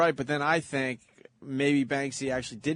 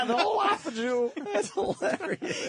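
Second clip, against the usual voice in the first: laughing. Real, not acted. Host cracking up at story.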